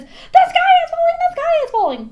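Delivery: very high, loud, laughing squawk; panicked hen cutting off the narrator; hysterical clucking. A narrator's reading. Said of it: "clucked Chicken Licken".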